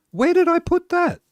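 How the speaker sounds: very high, squeaky-pitched alarm. Real, not acted. Streamer can't find the glass in the game, real high-pitched alarm. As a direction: suddenly realise it's missing, pitch shooting up. Searching for a lost item.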